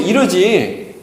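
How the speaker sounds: ordinary delivery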